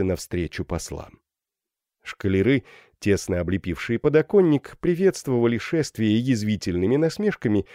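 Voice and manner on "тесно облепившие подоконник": ordinary delivery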